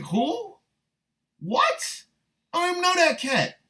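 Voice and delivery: high-pitched mock bewilderment; baffled disbelief. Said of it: "Like, she don't even know this dude".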